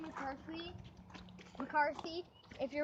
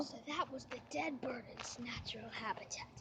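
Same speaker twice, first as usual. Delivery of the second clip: hushed wildlife narrator whisper; nature documentary; 2 semitones lower, 6 dB quieter than usual. Pretend. Whispered sign-off in documentary style.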